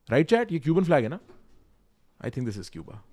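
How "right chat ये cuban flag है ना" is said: high, loud questioning rise; surprised question. Real, not acted. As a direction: check with chat, surprised. Asks chat with surprise. (हैरानी से ऊँचा सवाल)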